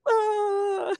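high, hammed-up crying wail; mock wail. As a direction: burst into an exaggerated sob. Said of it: "And I'd be like".

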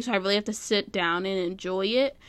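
normal talking voice

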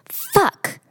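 put-on squeaky high kid voice, casual and matter-of-fact; toddler's blurted swear. Mom imitating her toddler's casual swear, high and childlike. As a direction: blurt it like a small child who means it. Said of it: "she said it so perfectly".

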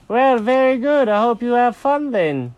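high, loud cartoon cat farewell; high-pitched, stiff well-wishing; huffy cat. Jenkins stiffly ends exchange.

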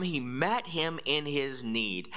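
ordinary talk, nothing marked

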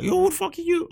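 high-pitched put-on challenge to a stranger; indignant mom. Imitates mom's indignant question to caller.

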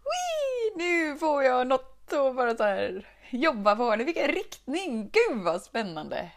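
very high, loud squeal of delight; overjoyed. Retold or imitated. Vlogger reliving finding a new problem, ecstatic high squeal. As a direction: squeal like a thrilled kid. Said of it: "Jag blev helt överlycklig".

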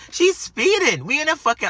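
high, loud alarmed cry; alarmed disbelief. Retold or imitated. To the boss about the speeding driver, high alarmed cry. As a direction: sound the alarm at her speed.

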